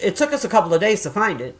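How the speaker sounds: usual speaking voice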